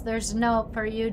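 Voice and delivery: ordinary talk, nothing marked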